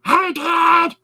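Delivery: very high, loud scratchy cat voice. Retold or imitated. Comedian's orange cat greets its dad, scratchy. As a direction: shriek it like a scratchy little cat. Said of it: "a really scratchy".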